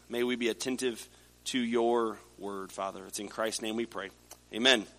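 hushed, reverent prayer voice. Acted. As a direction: pray softly for the listeners.

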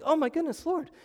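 high-pitched panicked cry imitating the disciples; mock panic. Disciples calling on Jesus in the storm, mock panic. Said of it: "They're panicking".

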